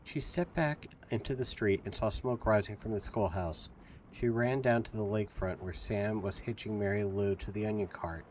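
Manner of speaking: ordinary delivery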